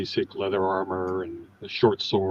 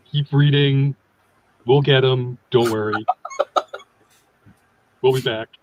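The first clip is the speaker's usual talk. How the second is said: loud, higher in-character sign-off; signing for a fan; 5 semitones higher, 5 dB louder than usual. Acted. Writer signs a fan's copy, hammy and loud. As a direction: call it out like a celebrity to a fan.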